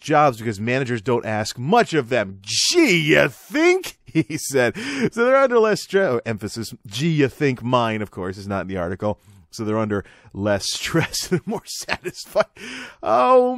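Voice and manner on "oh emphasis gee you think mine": ordinary talk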